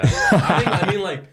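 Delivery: laughing. Real, not acted. Laughing at country voice claim.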